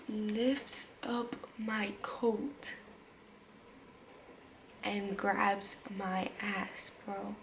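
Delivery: slow, hushed, unvoiced disbelief; barely voiced, slow and shaken; stunned whisper. Real, not acted. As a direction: breathe the words out in shock.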